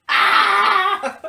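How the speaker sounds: loud high frustrated shout, laughing; frustrated yell. Retold or imitated. [name] fed up with the noisy kids, mock frustrated yell. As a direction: let out an exasperated scream.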